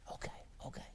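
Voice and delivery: put-on whispered little-boy voice; small child's hurried whisper. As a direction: whisper it like a scolded toddler. Child voiced in retold story.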